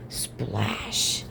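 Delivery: long, whispery drawn-out splash; sound-effect splash. A narrator's reading. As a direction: stretch the word into a hushed whoosh. Storyteller imitates the splash.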